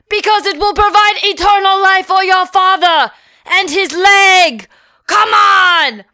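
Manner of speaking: high, loud, wild sales pitch; frantic yelling. Retold or imitated. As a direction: shout it like a mad director whipping up a crowd. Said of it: "Again, he said".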